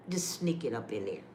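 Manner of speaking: low, soft sly aside. Real, not acted. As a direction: let them in on the trick. Sly low aside after laugh.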